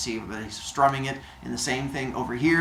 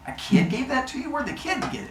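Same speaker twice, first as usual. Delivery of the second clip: high-pitched puzzled query; acted disbelief; incredulous questioning; 10 semitones higher, 4 dB quieter than usual. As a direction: doubt the story you've just heard. Zeus questions Apollo in disbelief.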